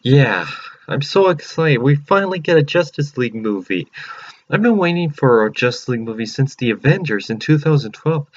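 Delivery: usual speaking voice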